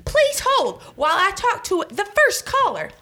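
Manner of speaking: high, sing-song phone receptionist voice; chirpy receptionist. Acted. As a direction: answer like an overeager call-centre girl. Phone receptionist character greeting.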